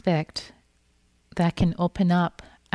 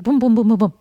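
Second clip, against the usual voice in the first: louder punchy booms, each hit hard; 4 semitones higher, 10 dB louder, faster than usual. Retold or imitated. Describing anger rising in the lunch line, loud booms. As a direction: pound out each boom like anger surging. Anger hitting all of a sudden.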